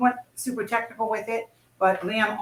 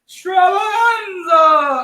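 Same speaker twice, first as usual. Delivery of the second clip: slow, loud, drawn-out shout; announcer call; 7 semitones higher, 8 dB louder, much slower than usual. Pretend. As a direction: belt it out like a ringmaster. Echoing the event name, hyped.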